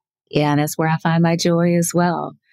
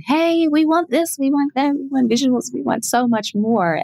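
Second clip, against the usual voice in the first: eager fans; 8 semitones higher than usual. Retold or imitated. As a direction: beg like excited fans. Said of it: "my fans have spoken".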